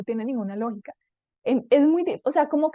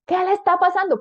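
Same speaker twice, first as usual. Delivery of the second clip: high, loud road-rage challenge; indignant raised-voice demand; mock angry driver; 8 semitones higher, 5 dB louder than usual. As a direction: confront another driver, hammed up. Acting out angry driver confrontation.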